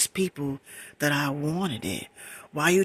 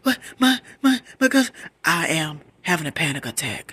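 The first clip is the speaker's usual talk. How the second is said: acted panic attack; 5 dB louder than usual. Calling to Ma during a panic attack, acted panic. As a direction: stammer as if unable to get words out. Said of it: "I'm, I'm like this, y'all".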